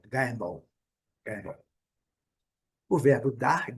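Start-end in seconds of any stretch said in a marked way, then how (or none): 0.1-1.6 "gamble" strong first syllable, much weaker second; "gamble" as strong-weak, dark L at the end. Shown as a demonstration. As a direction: stress the start of "gamble", drop the ending away. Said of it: "a segunda sílaba bem mais fraca".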